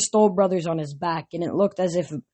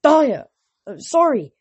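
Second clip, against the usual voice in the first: shocked apology; 8 semitones higher, 6 dB louder than usual. A narrator's reading. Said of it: "Thalia said, turning pale".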